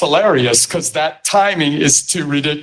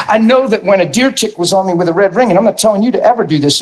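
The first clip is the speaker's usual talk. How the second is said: usual speaking voice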